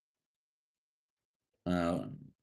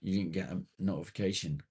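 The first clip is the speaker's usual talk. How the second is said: ordinary talk, nothing marked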